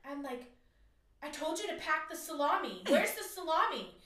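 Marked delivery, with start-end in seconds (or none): 1.2-3.9 louder, higher angry demand; wife freaking out at husband Pete over the salami. Retold or imitated. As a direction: snap at him over the lost salami. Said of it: "freaking out on Pete".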